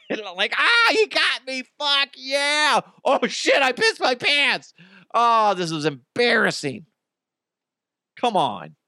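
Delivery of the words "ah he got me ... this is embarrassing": much higher, squealing with delighted glee; gleeful slapped diner. Pretend. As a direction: shriek with joy at being hit. Diner gleefully slapped, pants wet.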